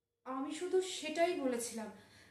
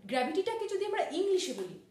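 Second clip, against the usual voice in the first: normal talking voice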